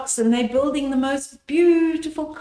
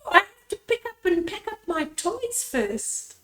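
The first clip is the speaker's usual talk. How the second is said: put-on high child voice; 8 semitones higher, 3 dB quieter than usual. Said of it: "Anna's saying".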